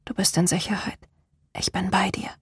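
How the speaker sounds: soft, hushed whisper; whispered reassurance. A narrator's reading. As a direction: whisper it to someone stirring in sleep. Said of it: "flüsterte sie". (beruhigend geflüstert)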